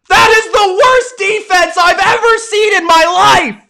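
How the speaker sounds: high, loud disbelief on worst; outraged shout. Real, not acted. Streamer yelling at the Packers defense, outraged shout. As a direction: shout it at the screen.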